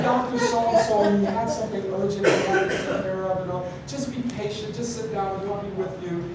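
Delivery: put-on high, soft, soothing old-lady voice; gently apologetic older lady; sweet grandmotherly receptionist. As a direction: placate him like a kindly grandma. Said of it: "kinda like a grandmother, just really nice".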